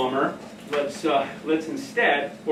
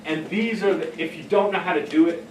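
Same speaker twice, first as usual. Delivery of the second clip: normal talking voice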